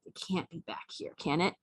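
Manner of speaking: hushed, breathy whisper. Retold or imitated. Owner searching behind her yarn for the mouse, whispering. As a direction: whisper so the mouse won't hear.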